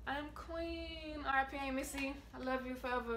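barely holding it together; emotional. Real, not acted. Remembering her late Aunt Missy, softly tearful. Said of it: "Feel a little emotional".

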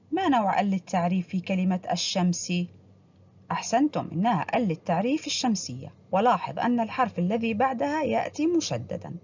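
normal talking voice

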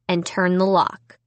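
ordinary delivery

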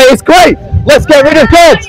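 mock atheist cheer. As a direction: mimic atheists cheering, still in a preacher's delivery. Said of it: "those of you going by who are atheists, you say".

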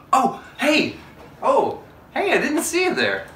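high, loud fake surprised greeting; hammed-up surprised hello; mock surprise.

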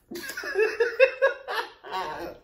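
high burst of laughter; shocked laughter. Real, not acted. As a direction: laugh out loud in disbelief. Label warns of chip punches.

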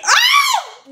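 sudden high shriek of shock. Real, not acted. Reacting to the clip with a shocked shriek. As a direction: yelp at what she just heard.

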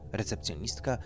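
ordinary delivery

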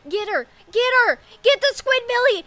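loud, high urgent yells into a long wailed no; frantic shouting. Real, not acted. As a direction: urge the chase on, then howl in dismay. Frantic chase in the race.

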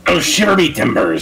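hearty pirate exclamation. Pretend. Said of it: "you are compelled to speak and act like a pirate".

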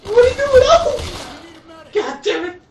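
loud, high cry of disbelief; shocked shout. Real, not acted. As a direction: yell it in total shock. Shocked at teammate's move.

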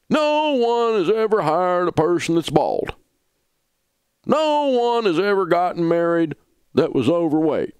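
a hint of a whiny drama queen; mock drama queen. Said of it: "turn into a drama queen like that".

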